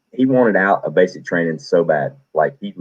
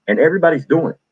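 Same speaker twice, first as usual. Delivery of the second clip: ordinary delivery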